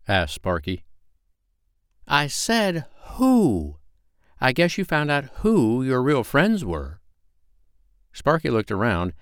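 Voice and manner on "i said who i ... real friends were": high, hooting owl scolding. A narrator's reading. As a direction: draw out the hoot, then lecture. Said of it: "said an owl".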